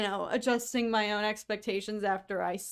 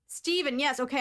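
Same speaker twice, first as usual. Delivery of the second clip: ordinary talk, nothing marked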